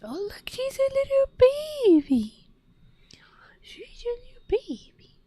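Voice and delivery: high, hushed cooing to a kitten. Real, not acted. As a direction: coo over the little cat. Baby talk to the cat.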